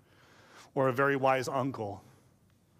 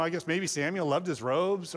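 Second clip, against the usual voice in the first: normal talking voice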